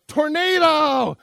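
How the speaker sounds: very loud, high, slow cry of warning; yelled alarm. Acted. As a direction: yell it out to scatter the hunters. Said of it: "And Mama Bear yells out".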